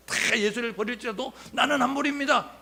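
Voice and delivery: high, loud proud vow; boastful vow. Retold or imitated. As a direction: declare it loudly as if the only faithful one. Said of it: "큰 소리로 예수님 앞에서 호언장담". (큰 소리로 자신만만하게 맹세하듯)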